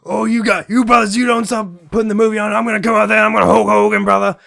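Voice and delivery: loud, high wrestler yell; full wrestling promo shout; Hulk Hogan impression. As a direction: roar it like Hulk Hogan threatening the Gremlins. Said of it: "he's just".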